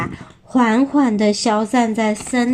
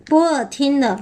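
plain reading voice

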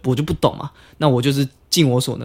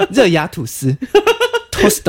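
slow mock Japanese intonation, laughing; Japanese accent; 8 semitones higher, slower than usual. Shown as a demonstration. Showing the co-host how Japanese people talk, mock Japanese accent. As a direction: put a Japanese lilt on the Mandarin and loanword. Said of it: "日本人讲话有一个腔调".

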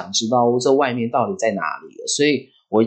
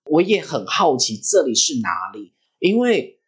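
normal talking voice

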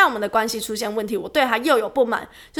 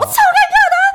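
high, loud laughing cry; laughing shout; 8 dB louder, faster than usual. Real, not acted. Agreeing with a friend she was so awkward, laughing shout.

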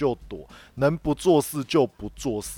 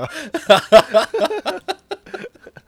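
high-pitched, loud burst of laughing; real laughter; 8 dB louder than usual. Real, not acted. Employee joking about his own stock losses, high loud laughter. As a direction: crack up in a long high laugh.

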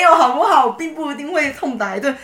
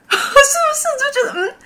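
laughing agreement; 4 dB louder than usual. Real, not acted.

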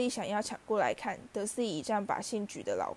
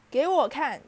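higher and louder, bossy demand; 5 semitones higher, 8 dB louder than usual. A narrator's reading. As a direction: order it like a spoiled kid. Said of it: "达利要求". (达利大声命令给他看)

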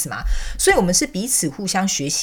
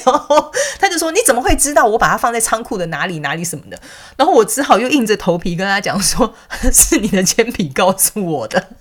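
laughing; 4 semitones higher, 5 dB louder than usual. Real, not acted. Still laughing telling story.

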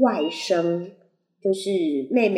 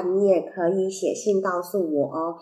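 usual speaking voice

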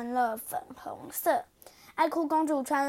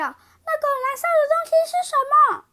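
very high, loud, fast shriek; sudden shout; 13 semitones higher, 8 dB louder, faster than usual. Acted. Princess shouting at the party, high shriek. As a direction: shriek it out in alarm. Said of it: "爱哭公主突然大叫".

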